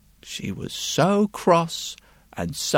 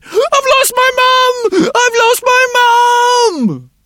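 put-on high wailing child's cry, loud and slow; a lost little boy shouting for his mum; distraught little boy; 11 dB louder, slower than usual. A narrator's reading. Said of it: "seemed quite distraught with worry".

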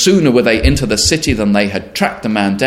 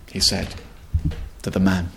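usual speaking voice

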